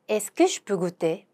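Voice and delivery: very slow, careful French question; slow model question; slow demo. Shown as a demonstration. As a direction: lay out each syllable for learners. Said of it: "pronunciation demo".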